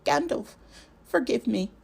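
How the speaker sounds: pleading cry. Acted. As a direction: beg forgiveness, a frightened young voice. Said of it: "he cried".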